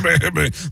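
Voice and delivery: spluttering rev-up noise; wordless stammer to get into character; John Madden. Shown as a demonstration. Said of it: "The rev up".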